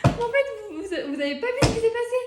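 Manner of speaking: high, loud laughing excitement; excited disbelief. Real, not acted. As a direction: blurt it out high through laughter. Just landed a lucky throw.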